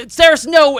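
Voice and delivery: yelling her name in horror; desperate shout. Acted. Corlin yelling after Saris in panic. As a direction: scream it as she runs to stop her. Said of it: "Corlin's like".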